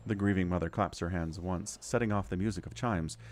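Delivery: ordinary talk, nothing marked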